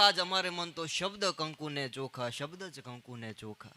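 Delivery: ordinary talk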